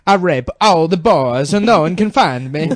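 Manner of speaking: hammed-up, vaguely Italian accent; mock foreign accent. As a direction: put on a vague European accent. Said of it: "It's like an Italian accent".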